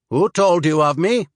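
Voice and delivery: put-on wary Swede sizing up a stranger, slight accent; slight Swedish accent. Swede asks the traveler who sent him, slight Swedish accent. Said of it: "He spoke with only a slight accent".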